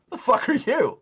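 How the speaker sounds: high-pitched incredulous comeback; comic imagined outrage; mock indignation. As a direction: throw it out high, hammed-up affront. Acting out unspoken reaction to old lady.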